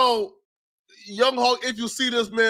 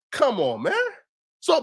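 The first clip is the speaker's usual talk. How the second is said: usual speaking voice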